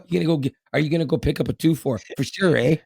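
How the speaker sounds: put-on fast, light Canadian accent with a rising "eh". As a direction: rattle it off like a Canadian buddy. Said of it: "so that is a Canadian thing".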